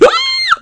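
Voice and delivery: high theatrical gasp; over-the-top fake gasp; mock gasp. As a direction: gasp like a scandalized pearl-clutcher. Mocking people who complain.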